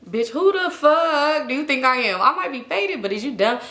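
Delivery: slow, high-pitched outraged retort; indignant. Acted. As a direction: stretch it out in mock outrage. Indignant retort to being sent off.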